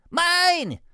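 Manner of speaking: very high, loud possessive cry; piglet squeal. A narrator's reading. Baby pig claims the small chair, squealing. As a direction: squeal it greedily. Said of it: "squealed Baby Sniff".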